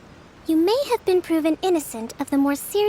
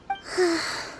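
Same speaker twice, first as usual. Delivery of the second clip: quiet drained exhale; soft tired sigh; 3 semitones higher, 4 dB quieter than usual. Acted. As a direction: breathe out the fatigue softly. Kokomi tired, low energy.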